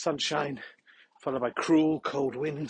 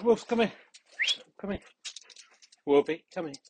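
calling the dog; 5 semitones higher than usual. Real, not acted. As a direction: call the dog over. Calling his dog in the field.

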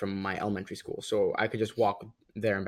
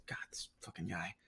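whispered thought; 4 semitones lower, 18 dB quieter than usual. Retold or imitated. Irritated inner thought about Travis.

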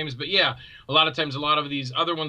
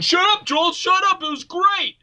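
high, loud hammed-up yelling; playful shouting back at the host; mock heckling fans; 5 dB louder than usual. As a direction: bark it like annoyed cult fans. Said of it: "they're saying".

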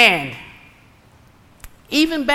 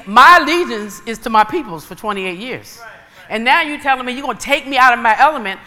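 ordinary talk, nothing marked

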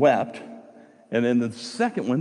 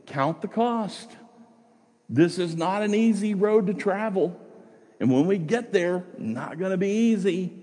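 earnest pleading; 7 semitones higher than usual. Retold or imitated. Naomi begging the widows to count the cost, higher and urgent. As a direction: plead with them, voice lifted and urgent. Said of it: "she's trying to plead with them".

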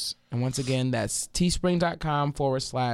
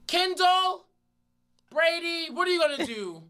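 calling from far away. Retold or imitated. Taunting him from far away, high calling voice. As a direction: call it teasingly across a distance. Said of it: "I said from far away".

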